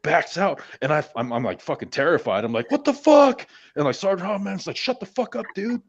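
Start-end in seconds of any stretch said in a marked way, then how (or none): 2.7-3.5 high, loud panicked cry; a panicked cry as the creature backs out; terrified yell. Retold or imitated. 4.8-5.8 high, hissed-sounding mock order; a sergeant shushing a jumpy private; the sergeant telling him to shut up on night watch; annoyed sergeant.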